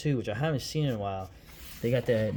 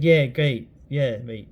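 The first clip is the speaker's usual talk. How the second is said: mock [name] brush-off; 8 dB louder, slower than usual. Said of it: "he got the letter and said".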